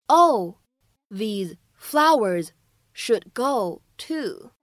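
slow, measured model reading with pauses between chunks; slow phrase-by-phrase reading. Shown as a demonstration. English teacher modelling a dialogue for learners, slow and segmented. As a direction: read it chunk by chunk for learners to repeat.

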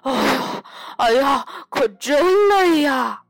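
loud breathy sigh into a drawn-out groan; puff, then wail with exhaustion; panting, exhausted. A narrator's reading. 艾迪 reaches the stream after carrying the bed, gasping for breath. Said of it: "艾迪大口地喘着气说".

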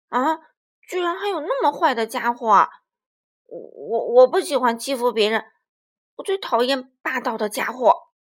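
big, stammering indignant lie; T-rex lying loudly to his new friend; loud fake outrage. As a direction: overdo the outrage to hide guilt. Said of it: "霸王龙又撒了个谎".

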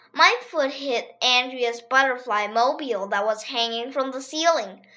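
plain reading voice

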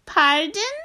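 very high, puzzled little frog; a tiny frog who didn't hear. Acted. Frog voiced, tables turned.

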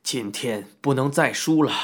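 low, stubborn sore loser; lightly voiced disgruntled card player; unwilling to concede. A narrator's reading. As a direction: grumble it low, refusing to accept defeat. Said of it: "不服气地说道".

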